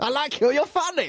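high put-on voice; imitating doctor. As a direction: say it like the quirky doctor.

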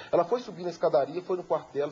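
ordinary talk

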